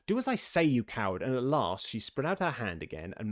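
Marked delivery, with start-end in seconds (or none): 0.1-1.2 high, loud start dropping on the insult; indignant rabbit command; bullying order. A narrator's reading. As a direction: scold the cowardly servant. Rabbit scolds servant.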